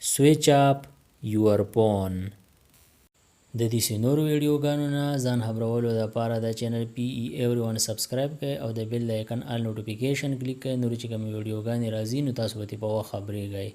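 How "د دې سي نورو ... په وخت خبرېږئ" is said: rapid, softer outro read; subscribe outro. Acted. Host asking viewers to subscribe, rapid and soft. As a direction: rattle off the subscribe plea quickly. Fast quiet channel outro.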